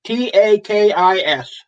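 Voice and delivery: "T A K I S" spelled letter by letter, slowly. Shown as a demonstration. Spelling the brand name to the phone, slowly. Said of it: "How do you spell it?".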